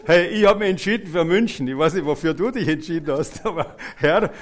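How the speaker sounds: loud, high, laughing begging for Munich; eager mock plea. Preacher playing a believer begging the Lord, loud and laughing. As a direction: ham it up like a greedy kid asking for a prize.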